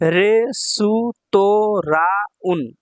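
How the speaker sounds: রেসুতোরাউন very slow, every syllable separate. Shown as a demonstration. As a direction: spell out রেসুতোরাউন in long beats. Teacher sounds out new word.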